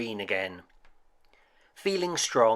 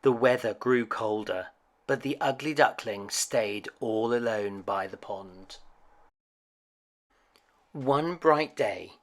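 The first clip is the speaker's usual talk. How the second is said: plain reading voice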